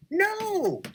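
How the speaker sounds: high, wailed retold protest; drawn-out high cry of disbelief; horrified fan pleading. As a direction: stretch it out in high-pitched mock horror. Retelling fan's horror at betrayal.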